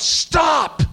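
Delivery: high, sudden shout of alarm; urgent high-pitched yell; yelled warning. Retold or imitated. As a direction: yell it like a parent halting a child. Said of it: "I yelled out".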